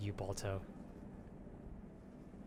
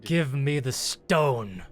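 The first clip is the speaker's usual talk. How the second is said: menacing demand; 3 semitones higher, 9 dB louder, much slower than usual. Acted. Captain repeats demand, menacing.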